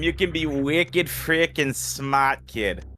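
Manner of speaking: slow, hammed-up Boston accent on wicked frickin' smart. Teasing Reem with a slow, hammed-up Bostonian accent. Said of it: "that's enough of the stereotypical Bostonian accent".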